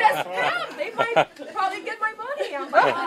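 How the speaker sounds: high, loud words through laughter; laughing hard. Real, not acted. Woman recalling her scam suspicions, laughing hard. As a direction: crack up while telling it. Joking about suspecting a scam.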